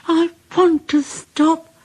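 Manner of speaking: high pleading engine voice; high weary whine; tired Percy. A narrator's reading. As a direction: plead to stop.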